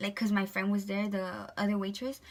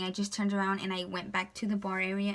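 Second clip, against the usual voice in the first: normal talking voice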